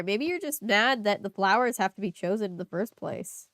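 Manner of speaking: usual speaking voice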